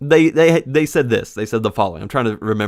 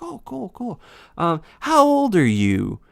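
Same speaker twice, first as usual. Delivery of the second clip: put-on high, childlike kid voice; imitating a kid; 8 semitones higher than usual. Kid at the fire pit asking the narrator, high childish voice. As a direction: chirp it like a curious little kid.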